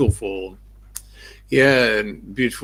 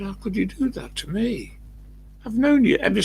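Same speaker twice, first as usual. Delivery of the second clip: reproachful; 12 semitones higher than usual. Retold or imitated. Retold confronting the thief friend.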